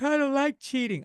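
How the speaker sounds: high, prissy objection; mock offended listener. Upset podcast listener objecting to cheating, high and prissy. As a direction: whine it like an offended prude. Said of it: "You're like".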